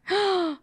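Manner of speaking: sharp high intake of breath; sudden pretend-fright gasp; mock scared gasp. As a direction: gasp in playful alarm. Mock fright at Ring of Fire.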